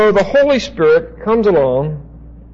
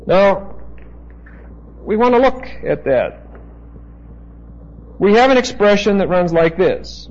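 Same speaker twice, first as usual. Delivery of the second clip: usual speaking voice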